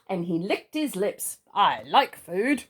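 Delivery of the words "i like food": greedy. Acted. Mister Greedy licks lips.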